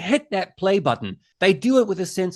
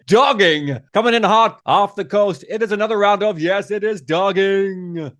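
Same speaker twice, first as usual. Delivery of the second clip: punchy mock announcer, dragging out the last words. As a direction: hype it like a breaking segment. Host spoofs an announcer's show intro.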